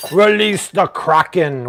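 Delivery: booming, slowed epic proclamation; movie trailer boom. Pretend. Cooking host booming a movie line over the sauce. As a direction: roll it out like a movie villain's command.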